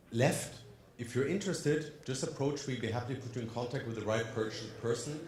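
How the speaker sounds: ordinary talk, nothing marked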